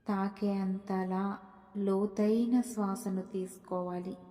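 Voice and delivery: soft, slow meditation voice with long pauses; hushed, unhurried guidance through deep breaths; guided meditation. Acted. Guide leading listeners through deep breaths, soft guided meditation.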